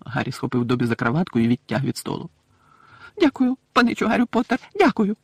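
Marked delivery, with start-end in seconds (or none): 3.2-5.2 high elf voice, a touch breathless; grateful little elf piping thanks; squeaky, mildly winded elf; panting elf, lightly played. A narrator's reading. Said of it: "задихаючись".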